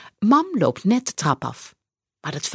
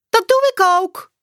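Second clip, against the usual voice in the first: kinderstemmetje Saar; 14 semitones higher, 6 dB louder than usual. A narrator's reading. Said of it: "zegt Saar".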